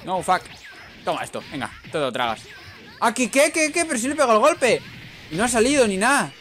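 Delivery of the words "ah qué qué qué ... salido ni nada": high, rising outraged questions; surprised disbelief. Real, not acted. Gamer shocked his hit did not land, disbelieving. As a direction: sputter in disbelief.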